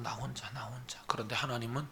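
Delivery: whispered, hushed lonely words. Acted. Pastor hushing the congregation, whispered. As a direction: whisper it as if alone.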